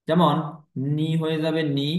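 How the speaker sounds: ordinary delivery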